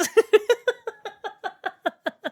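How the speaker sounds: high burst of laughter. Real, not acted. Laughs at his own accent.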